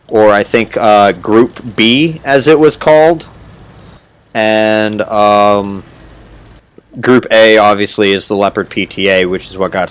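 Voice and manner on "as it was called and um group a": ordinary talk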